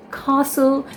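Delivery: "castle" with prolonged AH, KAH-sul; British pronunciation. Shown as a demonstration. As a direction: let the long A dominate "castle". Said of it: "it's prolonged".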